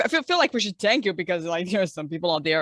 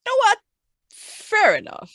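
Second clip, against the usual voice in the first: exclaimed acceptance; 8 dB louder than usual. Real, not acted. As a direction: throw your hands up and agree. Sudden resigned acceptance.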